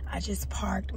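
normal talking voice

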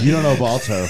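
loud, incredulous yell at a friend; disbelieving shout. Real, not acted. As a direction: shout it in mock outrage.

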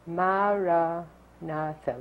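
Maranatha in four slow, evenly stressed syllables, ma-ra-na-tha; Maranatha, four even beats, unhurried; four equal syllables. Shown as a demonstration. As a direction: say Maranatha slowly, every syllable the same weight. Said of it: "we say it in four equally stressed syllables".

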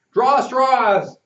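loud high group cry; the boys all yelling their choice; shout. Acted. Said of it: "we shouted".

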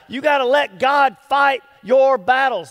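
loud, rousing call; preaching shout. Real, not acted. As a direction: drive it home to the church.

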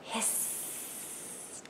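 very soft, drawn-out hiss; snake hiss. Shown as a demonstration. Reader showing children the sound, soft snake hiss. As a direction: let the s run on like a snake. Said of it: "just like a snake".

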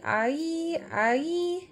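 usual speaking voice

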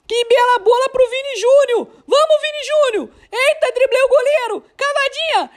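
rising high, excited play call; explosive goal commentary. Real, not acted. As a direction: build up as the goal comes. Goal building up. (narração de gol crescente)

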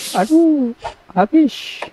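high, sudden cry of dismay; dismayed yelp. Real, not acted. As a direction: squeak it out in alarm. Healing item runs out.

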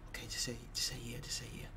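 hushed secret coaching; whispering. Real, not acted. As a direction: whisper the answer to him.

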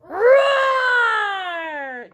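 big loud high roar; lion roar. Shown as a demonstration. Teacher roaring like the lion for the children, loud. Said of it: "don't forget to roar".

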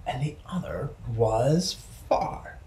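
small distant whisper. Shown as a demonstration. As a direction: whisper it, far away. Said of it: "far bird voiced distant".

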